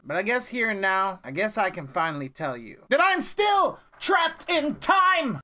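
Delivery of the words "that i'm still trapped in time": dramatic outburst. Acted. Dramatic trapped-in-time reveal.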